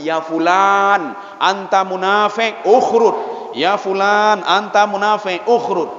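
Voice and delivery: slow, drawn-out public call; Prophet's dismissal. Acted. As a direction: call out the hypocrite, stretching each word. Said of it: "seperti yang dilakukan oleh Rasulullah". (menyeru dengan lambat untuk mengusir si munafik)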